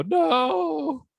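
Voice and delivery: very high "No" cry; high dismayed cry. Shown as a demonstration. Throwing his arms up at a disaster, a high cry. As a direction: wail "No" way up high. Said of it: "throw my arms up and go".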